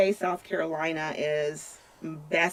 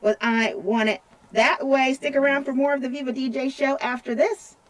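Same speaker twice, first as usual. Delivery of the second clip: radio DJ voice; 5 semitones higher, 4 dB louder than usual. Acted. DJ announces the next song.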